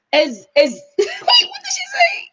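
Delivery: high squeal through laughter; helpless laughing question. Real, not acted. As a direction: crack up while trying to remember. Cracks up recalling the quote.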